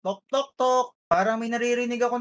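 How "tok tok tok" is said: a knock at the door, spoken aloud. Acted. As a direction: say tok tok tok like knuckles rapping a door. Knocking while listening at door.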